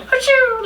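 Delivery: tiny, very high-pitched mock sneeze; mouse-like sneeze. Imitating someone's wholesome sneeze, tiny high mouse squeak. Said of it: "sounded like a little fucking mouse".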